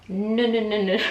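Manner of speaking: strict doctor. Retold or imitated. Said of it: "my doctor was very strict".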